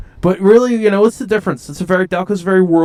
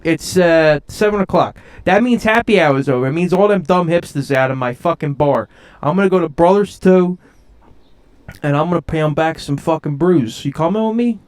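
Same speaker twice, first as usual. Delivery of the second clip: higher, brash South Philly tough guy griping; South Philly Matt; 3 semitones higher than usual. Acted. Host slips into his South Philly Matt character, brash and griping. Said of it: "now it's South Philly Matt again".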